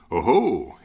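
loud startled oh-ho; exclaiming. Acted. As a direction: bark it out bold and loud. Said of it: "exclaimed the soldier".

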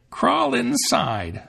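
very high, very slow witch command; a witch coaxing her into the oven. A narrator's reading. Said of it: "said the witch".

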